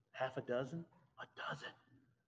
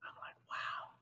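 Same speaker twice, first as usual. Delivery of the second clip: breathy hushed wow; a whispered wow at the sight; awed whisper; 9 dB quieter than usual. Retold or imitated. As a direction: whisper it in quiet awe. Awed by sea of blue.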